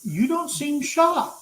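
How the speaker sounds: high, slow, mock-disapproving English lady voice; offended English lady. Scout leader scolds the narrator in a high, slow, prim English voice.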